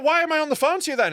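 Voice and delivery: high, loud, fast disbelieving outrage; exasperated outburst. Retold or imitated. Caller yelling at helpline guy, exasperated outburst. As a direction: snap back at the useless helpline man. Furious retort to useless helpline.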